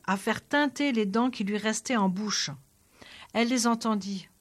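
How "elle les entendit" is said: plain reading voice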